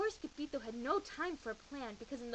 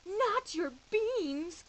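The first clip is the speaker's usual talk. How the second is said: much louder, slow, higher outraged cry; 7 semitones higher, 10 dB louder, much slower than usual. Acted. Skipito hearing the beans are stolen, outraged cry. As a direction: wail it in outrage. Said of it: "cried Skipito, outraged".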